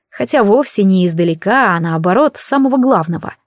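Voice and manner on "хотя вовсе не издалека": usual speaking voice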